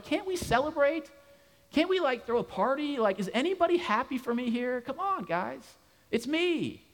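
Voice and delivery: high, pleading plea for a party; pleading excitement. Retold or imitated. Healed blind man begs his neighbors to celebrate, high and pleading. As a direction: beg his neighbors to just be happy for him. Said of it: "this guy's going".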